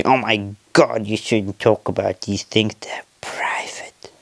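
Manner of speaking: slow whispered scold, fading away; a viewer tutting at him, slow and hushed; mock prim viewer. Mocking a viewer who scolds him, slow whisper. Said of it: "Before any of you say".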